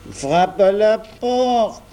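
put-on high, slow, drawn-out child's voice; high-pitched stretched-out prompt; childlike urging. A narrator's reading. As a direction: urge in a high little-boy voice, stretching the words. Boy urging knocking at door.

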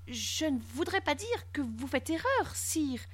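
soft, high, deferential voice; very polite. A narrator's reading. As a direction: contradict the king gently and meekly. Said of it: "répondit Barthélemy très poliment". (très poliment, voix douce et aiguë)

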